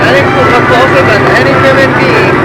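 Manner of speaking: loud, thrilled boast; excited. Real, not acted. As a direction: brag loudly about getting close. Proudly close to the building.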